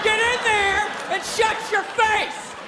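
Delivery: high, drawn-out mock-furious yell; hammered, stretched-out shouted order; shouted rebuke. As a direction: holler it like a boss cutting someone off. Cubicle snaps back at the worker.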